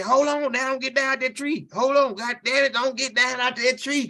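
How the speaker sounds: put-on dad yelling at drunk friend to stay in the tree; urgent warning. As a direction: warn him sharply not to climb down. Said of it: "My daddy said".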